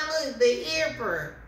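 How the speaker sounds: ordinary talk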